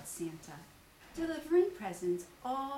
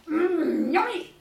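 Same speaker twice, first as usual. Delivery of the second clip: loud high yummy; Tigger excited; 6 semitones higher, 14 dB louder, slower than usual. Acted. Tigger smelling cookies, loud and high. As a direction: bounce in hungry and loud. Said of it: "cried Tigger".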